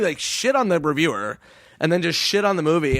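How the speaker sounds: normal talking voice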